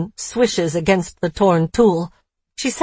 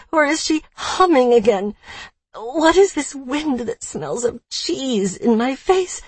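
usual speaking voice

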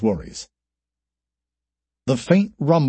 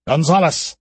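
called out; 5 semitones higher than usual. Acted. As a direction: call Gonzalez across the tunnel. Alistair calls out.

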